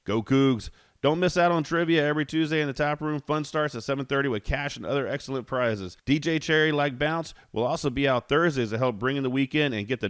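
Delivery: brisk sponsor read. Acted. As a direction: rattle through the sponsor copy with sales energy. Reads sponsor ads.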